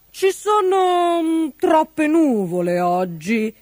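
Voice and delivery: slow, hesitant, drawn-out excuse; starts high, sinks as it stalls; stalling excuse. A narrator's reading. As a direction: stretch the words while hunting for an excuse. King invents a new excuse.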